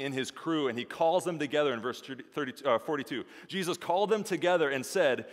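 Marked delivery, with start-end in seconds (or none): none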